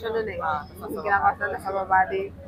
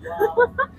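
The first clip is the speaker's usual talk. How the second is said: squealing laugh; 14 semitones higher than usual. Real, not acted. Laughing at retold scene.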